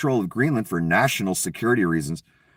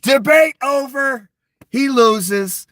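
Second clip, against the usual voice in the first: mock triumphant verdict; 11 semitones higher, 5 dB louder, much slower than usual. Mocking imagined debate outcome.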